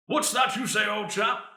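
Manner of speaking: lightly voiced pompous old Englishman; old British man. Host making a formal resolution, mock old British man. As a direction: play a stuffy old British gent. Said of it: "do the old British man".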